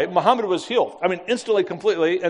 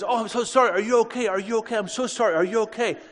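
frantic apology; 6 semitones higher than usual. Retold or imitated. Van driver apologising after the crash.

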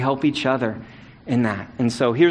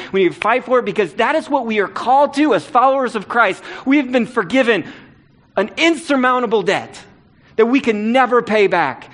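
higher and louder, driving each phrase home; preaching at full force; 8 semitones higher, 3 dB louder than usual. Acted. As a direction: preach it like a rallying call to the church. Preaching climax on forgiveness.

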